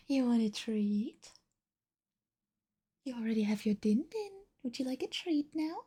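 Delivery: soft, lilting coaxing of a pet. Real, not acted. Streamer offers her cat a treat, cooing softly. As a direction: coo questions at the cat.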